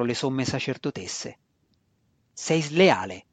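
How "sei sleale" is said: violent exclamation. A narrator's reading. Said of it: "esclamò Drist una terza volta con violenza".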